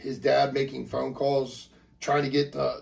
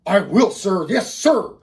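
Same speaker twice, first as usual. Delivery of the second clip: loud, high, dutiful answer; eager military reply; 5 semitones higher, 9 dB louder, slower than usual. Acted. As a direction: answer an officer proudly at full volume. Dad answering the soldier eagerly.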